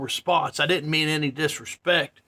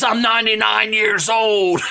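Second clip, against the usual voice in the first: loud, higher-pitched imitation of an irate man; mock shout; 6 semitones higher, 5 dB louder than usual. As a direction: holler it out as the worked-up stranger. Imitates irate homeless man announcing age.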